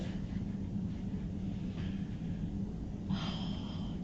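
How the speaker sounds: long, soft, breathy uncomfortable sigh. Real, not acted. Stuck in an awkward talk with Charles, sighing. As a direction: let out a heavy sigh.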